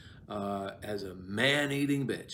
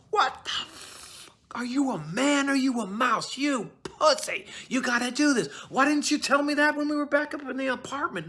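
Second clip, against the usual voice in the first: much higher, louder outraged gangster voice; Joe Pesci; 14 semitones higher, 4 dB louder than usual. Retold or imitated. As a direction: berate a hesitant partner in crime, loud and high. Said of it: "Joe Pesci would be like".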